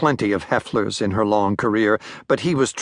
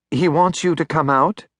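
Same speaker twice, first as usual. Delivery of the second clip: receptionist; 6 semitones higher than usual. A narrator's reading. Receptionist voiced.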